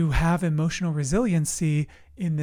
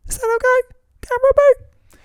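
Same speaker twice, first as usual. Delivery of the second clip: very high, loud squeaky character voice; silly put-on voice; 5 dB louder than usual. Host playfully re-asking the second camera, silly high squeak. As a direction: squeak it out far above your own voice.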